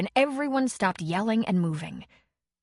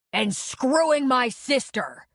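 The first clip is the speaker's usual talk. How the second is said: shouted; 6 semitones higher, 3 dB louder, slower than usual. A narrator's reading. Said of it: "Connor shouted".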